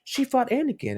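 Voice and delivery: put-on bright small girl remembering; little girl voice.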